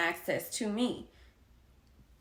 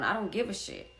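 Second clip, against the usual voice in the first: normal talking voice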